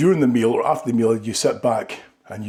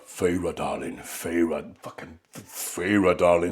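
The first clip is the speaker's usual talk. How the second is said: deep, slow seductive murmur; sultry Rhysand voice; 7 semitones lower, 4 dB quieter, slower than usual. Retold or imitated. Husband mimicking Rhysand wooing Feyre, deep seductive murmur. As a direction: purr her name low and slow like a fae lord. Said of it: "That's all I said".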